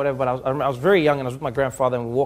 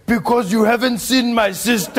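much higher, loud triumphant punchline; cheeky grandfather punchline; 8 semitones higher, 4 dB louder than usual. Retold or imitated. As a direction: land the joke with a shout. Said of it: "he's like".

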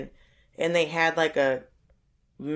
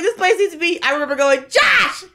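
higher, louder, laughing through the words; laughing outrage; 11 semitones higher, 6 dB louder than usual. Real, not acted. Telling Josh the restaurant must close, laughing loud and high. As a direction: cackle while demanding it close. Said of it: "bloody terrible".

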